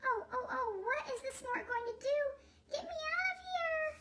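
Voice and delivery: high chick voice rising into panic; a little bird begging to get down; frightened baby bird. Acted.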